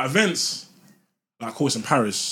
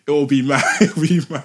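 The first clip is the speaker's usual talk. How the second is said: laughing; 5 semitones higher, 12 dB louder than usual. Real, not acted. Laughing at the idea.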